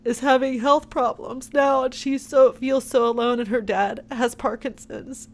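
holding back tears while speaking; teary-eyed. Real, not acted. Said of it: "I'm sorry I get teary-eyed".

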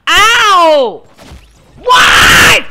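startled shout. Real, not acted. Hit in game.

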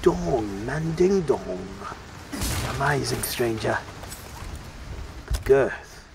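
slow, breathy whisper of a shady merchant. Retold or imitated. Player teasing the merchant, slow mock whisper. Mimicking the game's merchant again.

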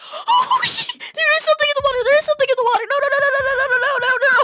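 high, loud, fast screaming; frantic panic. Real, not acted. Something is in the water, panicked screaming. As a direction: gabble it in rising terror.

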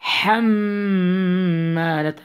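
حمالة slow, with a long hummed M; held ghunnah. Shown as a demonstration. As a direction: hold the doubled م in حمالة. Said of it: "You need to hold on it two counts".

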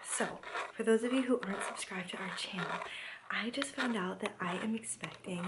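hushed whispered vlog intro. Real, not acted. Vlogger whispering her pregnancy news to the camera. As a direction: whisper so nobody in the house hears.

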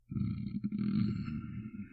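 slow, breathy whisper; hushed, drawn-out murmur of pleasure. Acted. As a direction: whisper it slowly, savouring each word. Intimate vampire roleplay, feeding.

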